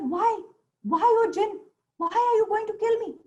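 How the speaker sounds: high-pitched, alarmed disbelief; surprised merchant. A narrator's reading. Merchant questions the jinn who threatens to kill him, surprised. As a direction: ask it high, stunned by the threat. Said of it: "The merchant was surprised".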